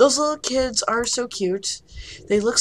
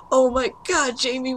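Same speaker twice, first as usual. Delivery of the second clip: ordinary delivery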